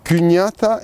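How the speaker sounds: kuñata with the stress moved between syllables; stress shift demo. Shown as a demonstration. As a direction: show how the accent tells two kuñata apart. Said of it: "Azentuak ere badu bere eragina".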